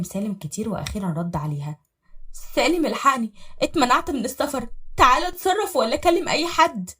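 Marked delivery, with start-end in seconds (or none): 2.5-6.9 higher, louder frantic wife; urgent cry for help; panicked plea. Acted. Wife calling husband after travel ban, panicked. Stopped at airport, panicked call.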